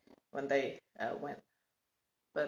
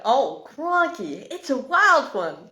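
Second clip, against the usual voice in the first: put-on loud, slow, high Australian accent; drawn-out mock Australian accent; 7 semitones higher, 12 dB louder, slower than usual. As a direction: ham up an Aussie bushman accent. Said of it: "Not like the, in Australia".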